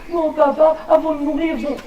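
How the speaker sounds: put-on high little boy voice. Jonas answers the teacher, high child voice. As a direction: pipe up like a kid.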